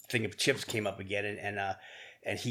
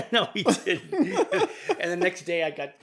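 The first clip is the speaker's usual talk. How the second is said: laughing; 10 semitones higher, 8 dB louder, slower than usual. Real, not acted. Laughs remembering the chip.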